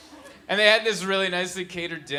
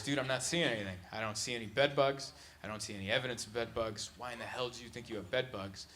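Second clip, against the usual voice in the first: doubtful exterminator; 5 semitones lower, 11 dB quieter than usual. Retold or imitated. Exterminator voiced, doubting the customer.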